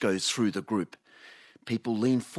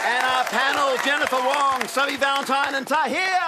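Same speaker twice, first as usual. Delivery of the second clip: high, loud showbiz announcing; announcer roll call; 6 dB louder than usual. Acted. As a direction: call out each name like a game show host. Host announces panel and audience.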